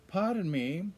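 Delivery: high-pitched, polite British lady; British lady's accent. Retold or imitated. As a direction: put on a high British accent, rising to a question. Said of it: "A lady with a British accent".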